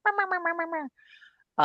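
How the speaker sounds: high-pitched rapid "mam-mam-mam" bickering noise; squabbling gibberish chatter. Acted. Inner parts mad at each other, mock squabbling. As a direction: imitate tiny voices yammering over each other. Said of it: "they were all mad at each other".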